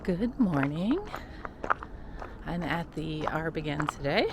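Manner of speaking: normal talking voice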